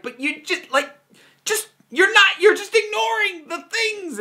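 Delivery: high, exasperated complaint; exasperated whine. Retold or imitated. Voicing frustration at playmate.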